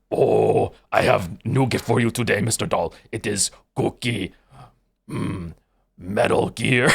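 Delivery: low, gravelly Cookie Monster voice. Pretend. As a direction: growl it like a hungry monster. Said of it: "I usually go".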